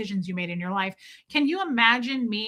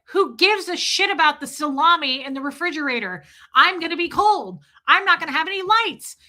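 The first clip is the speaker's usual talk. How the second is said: loud, high exasperated complaint; retold outrage; 6 semitones higher, 5 dB louder than usual. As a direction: protest it indignantly, pitch climbing. Acted quarrel with generator man.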